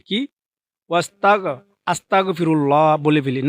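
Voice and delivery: ordinary delivery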